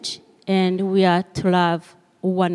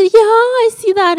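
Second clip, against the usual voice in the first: put-on child voice; 14 semitones higher, 4 dB louder, faster than usual. Daughter voiced.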